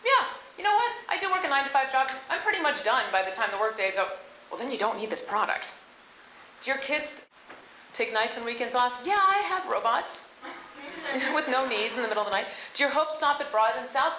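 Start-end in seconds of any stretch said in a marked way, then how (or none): 0.0-4.1 skeptical customer. Pretend. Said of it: "people going". 9.0-12.5 sarcastic customer. Pretend. Coach voices a mocking customer.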